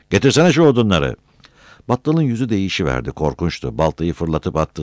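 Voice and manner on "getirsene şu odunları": raised, loud demand; insistent order. A narrator's reading. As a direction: press the order angrily. Repeated shouted order in quarrel.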